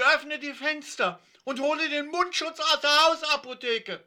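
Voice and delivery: very high, frantic shouted order; screaming. A narrator's reading. Christiane demands windows and masks, high frantic screaming. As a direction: shriek orders in a panic. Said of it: "schrie sie".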